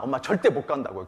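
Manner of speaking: ordinary talk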